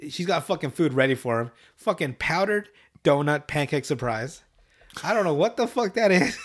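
usual speaking voice

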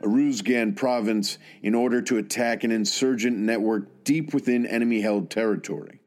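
formal, measured award citation read aloud. Real, not acted. Host sharing a fallen soldier's citation with everybody, formal reading. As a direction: read it out as an official military citation.

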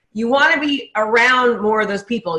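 usual speaking voice

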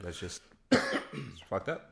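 coughing mid-sentence; coughing fit. Real, not acted. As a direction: talk through a cough. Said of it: "I'm sorry I'm coughing so much".

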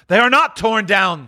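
high, loud exclamation of relief; overjoyed cry. Acted. Scrooge hugging his bed curtains, overjoyed cry. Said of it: "cried Scrooge".

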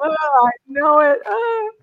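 real tears breaking into a high, loud cry; overcome, voice cracking with emotion; choked up. Real, not acted. Psychic moved to tears by a spirit hugging the viewer, crying. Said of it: "I'm gonna cry here".

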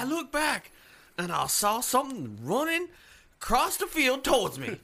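high, fervent Southern preacher voice. Pretend. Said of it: "sound like a Southern preacher".

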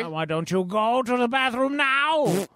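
a high, haughty put-on voice; pompous high voice. Pompous character taunting his rival, high and haughty. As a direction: lord it over him, sneering.

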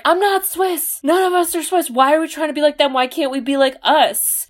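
high-pitched, pleading objection; mock exasperated protest. Podcaster acting Sanka objecting to copying the Swiss, exasperated protest. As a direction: argue it with theatrical exasperation, quickening midway.

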